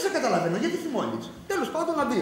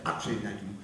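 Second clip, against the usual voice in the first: coaxing; 7 dB quieter than usual. Acted. Husband coaxing his wife.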